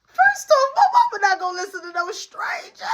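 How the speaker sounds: high, loud squealed scoff; loud, high-pitched ridicule; incredulous outburst. Real, not acted. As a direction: burst out in disbelief at the idea. Scoffing outburst at caller's offer.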